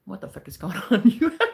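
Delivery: usual speaking voice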